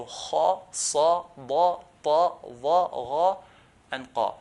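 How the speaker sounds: "kha, sa, ba, ta, va, gha, qa" thick and full; heavy letters. Shown as a demonstration. Teacher drilling seven letters, heavy manner. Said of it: "pronounce these seven letters in a heavy manner".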